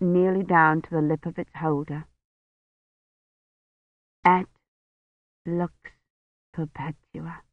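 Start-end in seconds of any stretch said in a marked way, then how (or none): 4.2-7.4 quiet prayer. Acted. Kivrin prays at dying priest.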